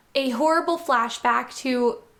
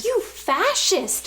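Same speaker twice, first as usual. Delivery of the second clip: slow, much higher scream; 7 semitones higher, slower than usual. A narrator's reading. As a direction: drag out the accusation, screaming high. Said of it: "she screamed".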